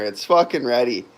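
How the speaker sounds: usual speaking voice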